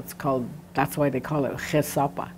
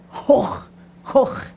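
"hoch" coughed up high from the throat; mock cough; cough sound; 9 semitones higher, 5 dB louder than usual. Shown as a demonstration. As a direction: imitate coughing. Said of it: "If you cough".

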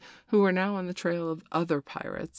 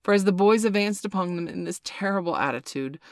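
ordinary delivery

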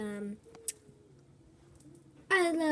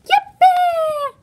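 very high, loud joyful yippee; excited shout; 18 dB louder than usual. Pretend. Lonely cat cheering at joining the dog club. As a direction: cheer at the top of your voice.

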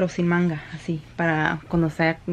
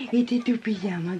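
normal talking voice

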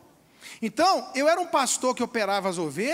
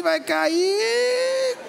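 higher, sing-song drawn-out taunt; sing-song tease; 10 semitones higher, 4 dB louder than usual. Retold or imitated. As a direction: sing it up as he goes over. Onlooker watching friend fall. (cantarolado agudo e zombeteiro)

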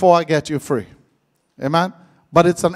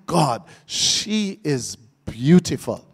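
low, slow admiring murmur of a man; softly, slowly marvel at her beauty; 6 semitones lower, 7 dB quieter, slower than usual. Retold or imitated. Man gazing at a woman, low slow admiring murmur.

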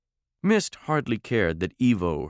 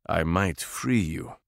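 a moneyed Russian aristocrat giving an order, pitched low; deep Russian warlord; 4 semitones lower, slower than usual. Acted. Warlord orders Mist down from her window, low Russian accent. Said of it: "Deep voice, Russian accent, moneyed, aristocratic".

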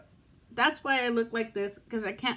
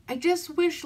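higher, choked-up wish; teary; 7 semitones higher than usual. Real, not acted. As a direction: voice breaking on the wish. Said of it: "sit here and cry on camera".

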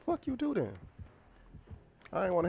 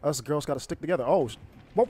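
normal talking voice

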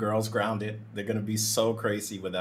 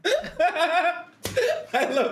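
very high, loud, drawn-out words breaking into laughter; helpless high-pitched laughing; laughing delight; 5 dB louder, slower than usual. Real, not acted. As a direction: squeal it slowly through high laughter.

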